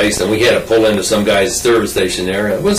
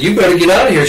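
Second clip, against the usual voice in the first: lightly imitated Georgia girl, pitched up; higher voice with a hint of Georgia accent; 7 semitones higher, faster than usual. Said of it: "a Georgia accent".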